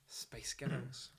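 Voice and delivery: soft, slow Scottish accent. Shown as a demonstration. Showing how Scots say Spice Girls, Scottish accent. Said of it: "That's how you say Space Girls in Sc".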